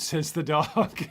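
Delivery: breaking into laughter on the words; laughing. Real, not acted. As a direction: crack up at your own joke. Cracking up at own joke.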